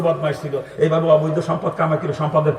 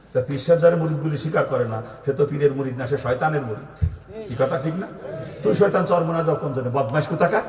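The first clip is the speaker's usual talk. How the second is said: usual speaking voice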